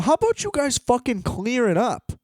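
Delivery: mock-indignant rhetorical question; exasperated jab. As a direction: throw it at them like an annoyed passenger. Ranting at train dispatchers.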